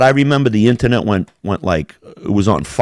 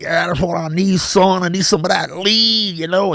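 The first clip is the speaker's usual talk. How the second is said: high, put-on lean-drinker voice; mock the man hyping his drink; exaggerated, high-pitched hype impression; imitated streamer shtick; 8 semitones higher than usual.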